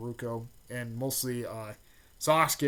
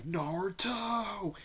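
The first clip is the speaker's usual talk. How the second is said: drawn-out, higher call of a rival's name; dramatic call; 7 semitones higher, 4 dB quieter, much slower than usual. Retold or imitated. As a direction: stretch the name out like a battle cry. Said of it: "Sasuke was like".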